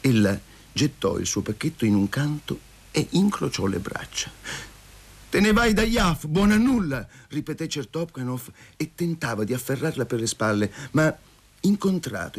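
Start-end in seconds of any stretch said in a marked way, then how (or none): 5.3-7.0 high-pitched jealous outburst; angry accusation. A narrator's reading. Jealous man scolds the woman leaving him, angrily. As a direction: throw the insult at her, pitch raised.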